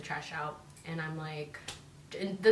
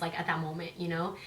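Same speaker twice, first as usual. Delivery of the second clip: usual speaking voice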